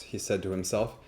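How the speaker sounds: ordinary talk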